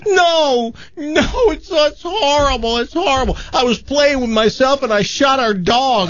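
high, crying, hammed-up sobbing wail; hysterical sobbing son. Son running to mother, hammed-up high sobbing confession. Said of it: "he is sobbing. He's hysterical".

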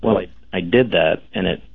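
ordinary talk

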